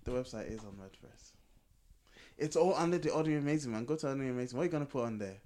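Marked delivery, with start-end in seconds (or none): none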